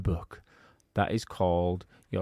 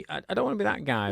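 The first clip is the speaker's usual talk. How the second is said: quick and higher-pitched; catchphrase; 8 semitones higher, much faster than usual. Shown as a demonstration. Teasing cohost, launching his catchphrase quickly, higher. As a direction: rattle it off as his pet phrase. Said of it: "why do you laugh when I start saying".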